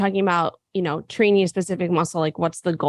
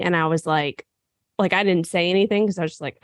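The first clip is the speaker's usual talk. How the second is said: ordinary delivery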